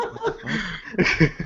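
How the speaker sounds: slow, laughing "what the fuck"; baffled and cracking up; laughing disbelief. Retold or imitated. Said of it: "I'm just like".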